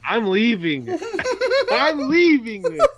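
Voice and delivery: high, laughing mock outrage; mock storming out. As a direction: threaten to walk out, drawn out and cracking up. Mock walk-out amid laughter.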